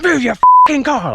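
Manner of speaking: high, loud, slow furious shout; aggressive yell. Retold or imitated. Big guy shouting from his car, high loud aggressive yell. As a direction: bark it at him aggressively. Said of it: "like, really aggressively".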